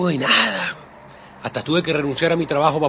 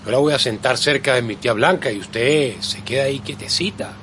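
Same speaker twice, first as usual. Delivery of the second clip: normal talking voice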